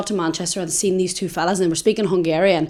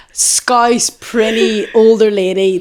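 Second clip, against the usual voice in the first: ordinary talk, nothing marked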